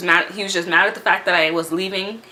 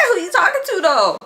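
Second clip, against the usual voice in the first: high, laughing who-you-talking-to; snapping back at the guy on the bus, high and amused; incredulous challenge; 11 semitones higher, 3 dB louder than usual. Retold or imitated. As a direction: challenge him, half laughing. Confronting the aggressive guy.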